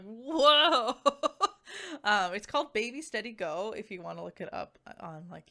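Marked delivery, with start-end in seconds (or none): 0.0-0.9 loud, high, amazed whoa with a laugh. Retold or imitated. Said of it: "I was just like". 1.0-2.0 giggly laugh. Real, not acted. Laughs after whoa.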